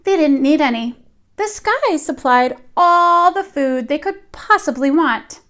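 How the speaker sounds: ordinary delivery, nothing marked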